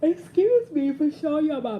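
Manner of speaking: talking through a yawn. Real, not acted. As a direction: stretch words in a yawn. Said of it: "that yawn came out of nowhere".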